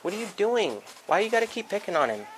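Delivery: high-pitched scolding at the pets. Real, not acted. Owner scolding pets for picking on each other, high scolding.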